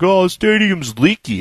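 slow mocking gripe; mocking complaint. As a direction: imitate a petty complainer, slowly.

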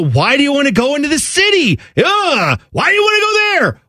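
high, fast mock Jersey disbelief; scornful Jersey local. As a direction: scoff at the city like a Jersey native.

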